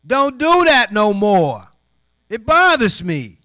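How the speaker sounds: sudden loud, high, slow reprimand; booming acted-out telling-off; stern fatherly scolding. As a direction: burst out with each word drawn out. Scolds the young lady.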